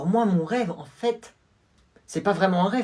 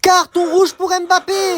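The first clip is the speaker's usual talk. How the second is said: loud, higher sports commentator call; match commentary; 13 semitones higher, 9 dB louder, slower than usual. Acted. Commentator calling the match to viewers, loud and high. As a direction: call the goal for the whole stadium.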